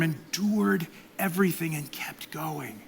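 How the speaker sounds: hushed, breathy whisper of awe; softly whispered sermon line. Real, not acted. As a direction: drop to a whisper for reverence. Preaching on Jesus' endurance.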